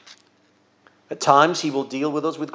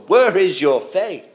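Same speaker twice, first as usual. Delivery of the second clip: strong rebuke; 4 semitones higher, slower than usual. Retold or imitated. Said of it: "needed a strong rebuke".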